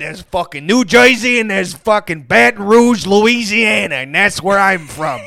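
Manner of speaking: loud, high, swaggering Southern tough; tough Louisiana guy. Acted. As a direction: bark it like a Cajun hardass. Gruff tough-guy bit.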